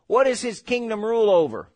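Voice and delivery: usual speaking voice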